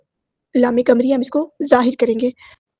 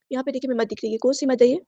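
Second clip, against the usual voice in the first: ordinary talk, nothing marked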